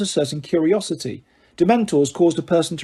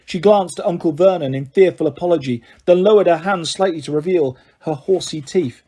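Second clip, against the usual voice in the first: ordinary delivery, nothing marked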